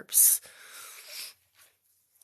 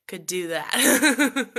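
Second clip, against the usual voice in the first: loud; 5 semitones higher, 10 dB louder, much slower than usual. Real, not acted. Bragging about legendary burp.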